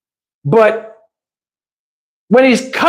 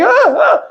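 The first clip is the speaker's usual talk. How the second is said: very high mock yelps; imitated yelps. Woman being hit by John, mock high-pitched yelping. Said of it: "She was like".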